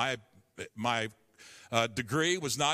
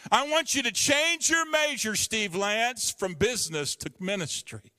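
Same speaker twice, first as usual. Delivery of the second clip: proclaiming; 6 semitones higher, 4 dB louder than usual. Retold or imitated. God calling him to ministry.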